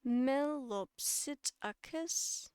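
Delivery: Melopsittacus drawn out very slowly, piece by piece; slowed breakdown. Shown as a demonstration. Teacher breaking the scientific name down for viewers, slowed right down. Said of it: "to slow it down, to break it down".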